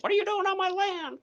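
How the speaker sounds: very high put-on voice; mock indignant challenge. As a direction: challenge trespassers in a squeaky mock voice. Acts out challenge to intruders on land.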